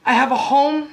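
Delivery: spoken-word poem. Acted. Poet performing an alternate-universe poem to an audience, impassioned spoken word. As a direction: perform it as rhythmic, emphatic slam poetry.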